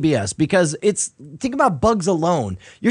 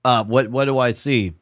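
usual speaking voice

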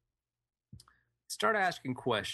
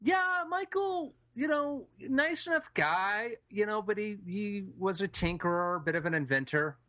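put-on old lady voice; 6 semitones higher than usual. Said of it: "Can't you tell by my deep voice".

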